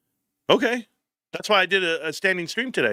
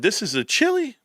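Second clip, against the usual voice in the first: disbelief; 9 semitones higher than usual. Retold or imitated. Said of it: "I said".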